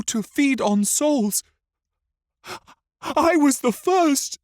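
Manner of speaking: put-on very high, loud child's cry of self-blame; terror-stricken boy. A narrator's reading. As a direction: cry out in a distraught boy's voice. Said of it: "Simon shook as the terrors".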